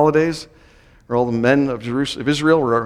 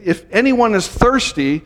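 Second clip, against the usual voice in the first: raised, emphatic public call; dramatic loud-voice proclamation; 5 semitones higher than usual. Retold or imitated. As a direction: proclaim it dramatically to a crowd, easing gradually. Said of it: "this unusual and dramatic way".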